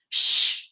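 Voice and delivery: long hushing shh like a stern librarian; forceful breathy shush. Shown as a demonstration. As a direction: shush it out like you're told off in a library. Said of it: "Send it into the earth with that sound, like this".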